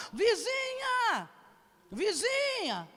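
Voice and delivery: high, very slow call; drawn-out calling. Retold or imitated. Man calling the neighbour to come help, high drawn-out call.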